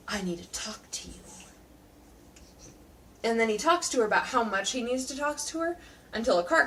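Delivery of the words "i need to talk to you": brooding hero voice. Retold or imitated. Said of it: "he's like".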